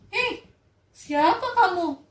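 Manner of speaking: loud, high, scornful shout; arrogant rebuff. Acted. Malin rejecting his mother, loud scorn. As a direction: push her away with contempt. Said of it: "katanya gitu".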